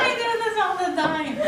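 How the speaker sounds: words spoken high through an embarrassed laugh; laughing through tears. Real, not acted. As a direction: laugh at yourself for tearing up. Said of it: "I'm gonna cry".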